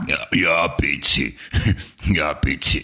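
choked, strained throaty voice; swallowed wrong. Acted. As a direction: talk as if something went down the wrong way. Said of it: "warum redest du so komisch jetzt".

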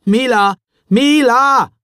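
loud, high call across the train; boy calls out for his lost sister. A narrator's reading. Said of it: "rief er noch einmal".

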